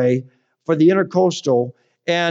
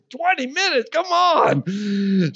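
incredulous; 7 semitones higher, slower than usual. Retold or imitated. Dismayed at tow delay.

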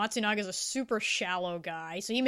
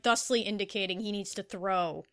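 ordinary talk, nothing marked

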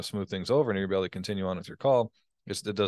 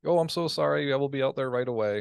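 much higher, fawning sorry voice; overly sorry service voice, higher; mock apologetic; 6 semitones higher than usual. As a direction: grovel like a customer service rep, pitched up. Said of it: "being apologetic's not".

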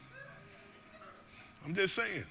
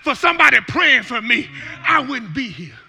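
loud, high shouted preaching over the music; a pastor shouting the message; full-force preaching; 17 dB louder than usual. Acted. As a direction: preach it hard to the whole church.

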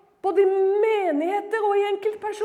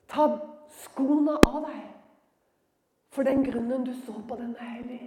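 voice of God; 7 semitones lower, 4 dB quieter than usual. Acted. God speaking to Moses at the bush, solemn and low. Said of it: "kommer denne røsten".

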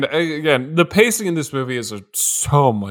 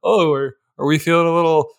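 higher teasing singsong taunt; mock gloating; 5 semitones higher than usual. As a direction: gloat over her comeuppance. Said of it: "Barbie's like".

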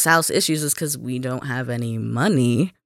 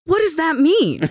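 incredulous; 13 semitones higher than usual. Retold or imitated. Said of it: "I'm like".

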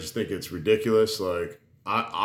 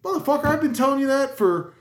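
high, strained fed-up father; hammed-up dad venting at his kid; exasperated dad. Dad fed up that his kid listened to a stranger, exasperated. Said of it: "I'm like".

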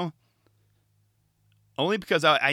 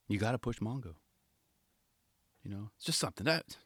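Rodney impression. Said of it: "That was my horrible Rodney impression".

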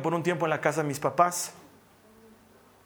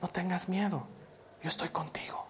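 hushed, near-whispered reassurance; father soothing his baby, barely voiced; soft into the ear; 9 dB quieter than usual. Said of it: "empecé a hablarle suavito al oído".

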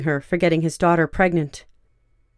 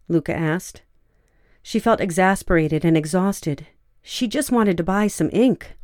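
usual speaking voice

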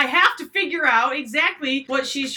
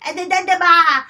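put-on toddler babble; 8 semitones higher, 9 dB louder than usual.

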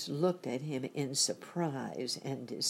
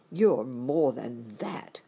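slow, louder, mildly hurt; grieved tone; 2 semitones lower, 6 dB louder, slower than usual. A narrator's reading. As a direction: object with a touch of grief. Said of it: "in a grieved tone".

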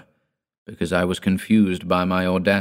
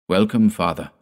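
ordinary delivery

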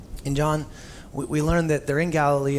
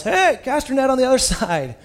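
higher, louder call across the water; called from shore; 9 semitones higher, 5 dB louder than usual. Retold or imitated. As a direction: shout it out to the boat, half laughing. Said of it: "from the shore yells".

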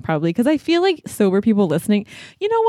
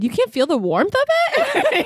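teasing question; 12 semitones higher, slower than usual. Retold or imitated. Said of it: "And I said".

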